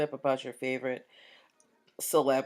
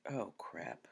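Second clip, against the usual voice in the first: muttered aside; 8 semitones lower, 14 dB quieter than usual. Real, not acted. Notices mic muted, to herself.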